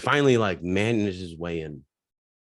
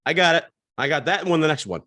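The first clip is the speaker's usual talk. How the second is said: higher, louder, calling across the bar; eager shout; 7 semitones higher, 4 dB louder than usual. Retold or imitated. Pete calling from across the bar, loud and high. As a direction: jump in loudly to claim the round. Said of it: "Pete goes".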